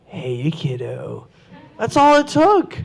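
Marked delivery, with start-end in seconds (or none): 0.1-1.3 low, slow and hushed villain line; quietly sinister hello; lightly voiced creepy villain; creepy clown greeting. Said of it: "all I did was say".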